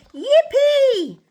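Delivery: very high, loud whoop; crying out. A narrator's reading. Narrator voices tyrannosaurs seeing a bridge, crying out. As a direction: let out a gleeful cheer. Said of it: "and the tees cried out".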